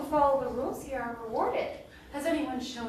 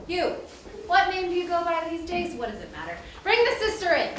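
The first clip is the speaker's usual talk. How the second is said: curt, raised regal command; imperious; 6 semitones higher, 4 dB louder than usual. Acted. Mistress summons the sister, imperious. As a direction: order the servants around. Imperious figure orders servants about.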